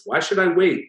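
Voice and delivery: high, loud, indignant question; sharp challenge. A narrator's reading. Barbara challenging her husband at the door, loud and high.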